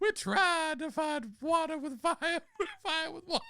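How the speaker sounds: high drawling Scarlett O'Hara voice; Southern belle voice. Acted. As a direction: falsetto Southern lady. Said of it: "She's, she's Scarlett O'Hara".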